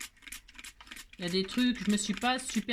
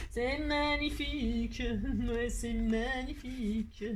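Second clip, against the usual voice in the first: slow, stretched-out gushing; drawn-out admiration; much slower than usual. Real, not acted. Crafter admires the butterfly print, slow and drawn-out. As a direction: draw every syllable out long in wonder.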